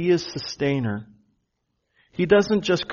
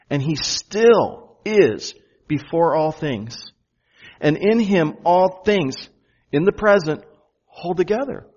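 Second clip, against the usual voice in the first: very slow and louder, "still" hit hard; slow emphatic build; 3 semitones higher, 4 dB louder than usual. Shown as a demonstration. Preacher driving the point home to the congregation, slow and rising. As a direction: climb higher and louder, spacing the words. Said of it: "Did you notice the present tense?".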